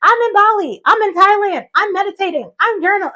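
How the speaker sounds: bubbly sharing. Retold or imitated. Posting her journey to followers, sharing in a bubbly voice. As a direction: gush to followers. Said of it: "I was like".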